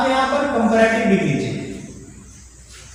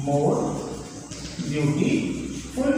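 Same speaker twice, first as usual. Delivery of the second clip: more beautiful drawn out very slowly, softer; slow dictation; 6 semitones lower, 7 dB quieter, much slower than usual. Shown as a demonstration. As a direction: stretch out "more beautiful" as if dictating for students to copy. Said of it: "तो हम क्या लिखेंगे यहाँ पर".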